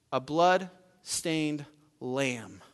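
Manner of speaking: very slow, with long pauses; hushed awe, drawn far out; solemn reveal. Real, not acted. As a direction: let each word land gravely. Solemn reveal after expected lion.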